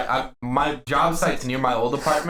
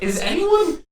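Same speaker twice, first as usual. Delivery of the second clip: very high, slow, pleading to onlookers; mock outraged plea to anyone watching; incredulous appeal; 3 dB louder, slower than usual. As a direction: call out in disbelief to bystanders. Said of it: "And I was like".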